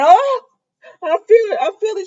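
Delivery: high, loud imitated cry of pain; mock labor pains. Jan in therapy claiming labor, mock pained cry. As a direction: wail it in hammed-up agony.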